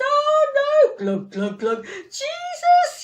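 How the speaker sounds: high, gurgling panicked cry; sinking panic. Acted. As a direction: splutter then cry out as he sinks. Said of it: "he starts shouting".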